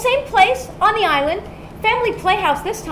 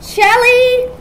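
high, loud call down the stairs; raised, drawn-out summons; 9 semitones higher, 7 dB louder than usual. Real, not acted. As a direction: holler a name to someone out of sight. Calling Shelley from downstairs.

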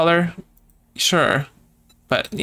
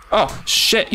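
startled yelp; 7 semitones higher than usual. Real, not acted. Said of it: "you scared the crap out of me".

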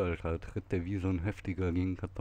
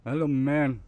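loud, raised call; 3 semitones higher, 7 dB louder than usual. Real, not acted. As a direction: call out to someone far away. Calling out to a game character.

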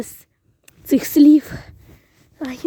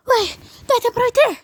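normal talking voice

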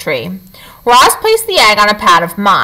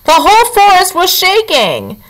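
fox voice; 9 semitones higher than usual. A narrator's reading. Fox character voice.

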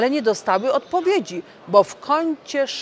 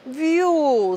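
whoosh; 8 semitones higher, 4 dB louder than usual. Acted. Plane flies up to ceiling.

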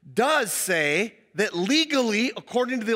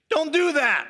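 passive-aggressive jab; 6 semitones higher, 4 dB louder than usual. Retold or imitated. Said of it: "passively, aggressively, and in a clear shot".

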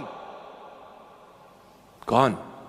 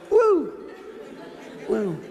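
mock singer whoop; 2 semitones higher than usual. Preacher mocking a singer's stage whoop, laughing. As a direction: whoop like a pop star on stage. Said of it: "this guy went".

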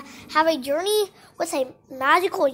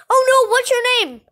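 higher-pitched pretend dismay; mock alarm; 6 semitones higher, 4 dB louder than usual. Hero puppet meeting the bad guy, alarmed. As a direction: cry out in play-acted alarm.

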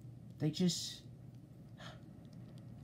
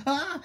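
high shriek; frightened scream; 13 semitones higher, 3 dB louder than usual. Acted. Refusing to go near it, frightened scream.

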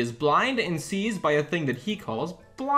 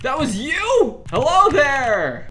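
much higher, louder, drawn out; surprised loud greeting; 11 semitones higher, 9 dB louder, slower than usual. Real, not acted.

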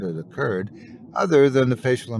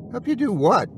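sharply higher, disbelieving; incredulous question; 11 semitones higher than usual. Acted. Jack balks at Ray's plea, incredulous and much higher. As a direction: toss it back in disbelief.

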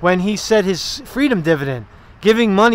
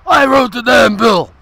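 hammed-up indignant shout, higher and louder; mock candidate shout; 4 semitones higher, 5 dB louder than usual. Impersonating a candidate defending his bill, mock shout. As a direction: bellow it like a fed-up candidate at a debate.

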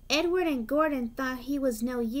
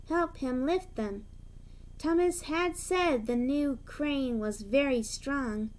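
usual speaking voice

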